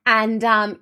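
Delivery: ordinary talk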